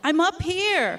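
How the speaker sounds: high, loud call up from a tightrope; yelled call from above. Retold or imitated. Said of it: "a foreign man yelled".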